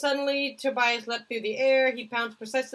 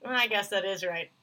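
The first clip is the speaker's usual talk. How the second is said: ordinary talk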